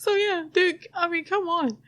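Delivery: high, squeezed laughing voice; real giggles pushing the voice up; talking through laughter. Real, not acted. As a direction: try to speak while cracking up. Helpless laughter at the story.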